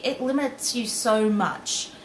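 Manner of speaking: ordinary talk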